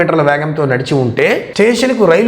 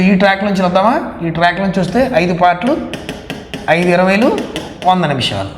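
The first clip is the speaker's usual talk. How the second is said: normal talking voice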